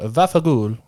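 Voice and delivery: Italian pronunciation. Shown as a demonstration. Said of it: "My favorite is".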